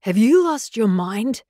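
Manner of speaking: loud, slow indignant growl. A narrator's reading. Stranger to the girl who jumped on him, growled. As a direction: growl it out slowly and loudly. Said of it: "He growled".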